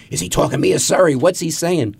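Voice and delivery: high, quick pestering questions; excited onlookers. Acted. Young coworkers crowding round a phone call, high excited curiosity. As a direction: pepper him like eager young coworkers. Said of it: "They were the secretaries".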